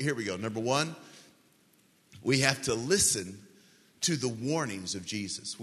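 ordinary talk

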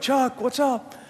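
high-pitched retold greeting; calling back. Calling back across the sidewalks.

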